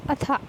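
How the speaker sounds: अथा ending in an aspirated "ha"; teacher's model of अथा with its breath ending; visarga as ha. Shown as a demonstration. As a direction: demonstrate the हा sound closing अथा. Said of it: "हा का sound देता है".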